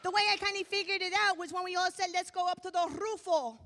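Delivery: loud, high mock Spanish gang accent. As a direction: keep up the put-on Spanish street-gang talk, punchy. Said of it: "we all talk like this".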